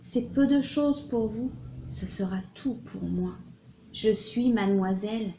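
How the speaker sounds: measured, expressive literary reading with swells and pauses; dramatic love-letter reading. Acted. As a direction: read it as an ardent lover's confession. Reads a love letter aloud.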